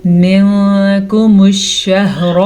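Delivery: मिनकुमुश slowly with the n hidden before k; slow tajweed demo; ikhfa demo. Shown as a demonstration. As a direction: stretch मिनकुमुश शहर with a nasal ghunna. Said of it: "तो हम इसको पढ़ेंगे".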